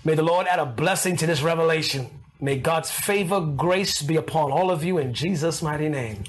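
low, measured blessing; deep-voiced closing prayer; benediction. Real, not acted. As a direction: pronounce a blessing in a low register.

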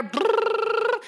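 high, slow rolled brrrr like a phone; mock ringing phone buzz; phone ring imitation.

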